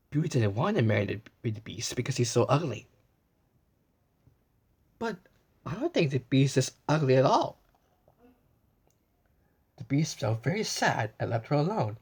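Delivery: plain reading voice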